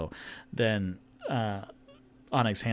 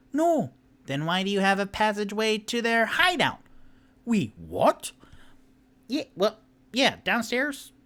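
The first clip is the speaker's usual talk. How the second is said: high halfling voice; 10 semitones higher, 4 dB louder than usual. Acted. Halfling answers questions.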